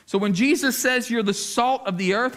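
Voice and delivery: ordinary delivery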